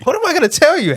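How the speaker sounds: very high, incredulous squeak; mock-helpless comeback; retold exasperation. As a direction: protest with pitch shooting up in disbelief. Acted exasperated reply in quarrel.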